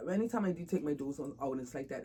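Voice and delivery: ordinary delivery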